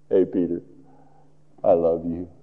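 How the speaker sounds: low, hushed affection; tender forgiveness. Acted. Jesus to Peter after his denial, low and loving. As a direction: reassure a friend gently, voice dropped low.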